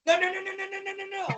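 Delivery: high, rapid string of noes; vocal wrestler yelping through a match; Mike Sharp. Retold or imitated. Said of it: "All the yelling, all the".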